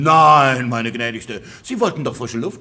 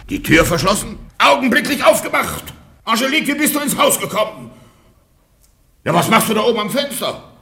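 higher, louder indignant shouting; loud outraged demands; angry shouting; 5 semitones higher, 5 dB louder than usual. Acted. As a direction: bellow up at the window. Locked-out husband shouts up.

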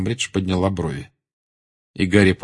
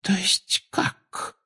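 high, softer, drawn-out question; taken aback; 12 semitones higher, 5 dB quieter, much slower than usual. A narrator's reading. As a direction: stretch out a disbelieving question. Professor reacts, taken aback. (протяжный, недоумённый вопрос)

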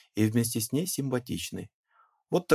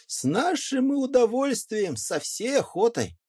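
much higher, fawning and eager; eager peasant; 14 semitones higher, 3 dB louder than usual. Acted. Peasant eagerly agreeing to guide the gentleman: high, fawning voice. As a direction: leap at the offer, voice way up. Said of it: "peasant eagerly accepts the job".

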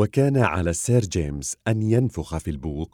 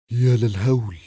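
horrified gasp; 5 semitones higher, 4 dB louder than usual. A narrator's reading. Narrator sees the monster rise.

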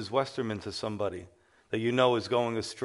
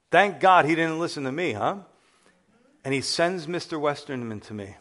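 ordinary talk

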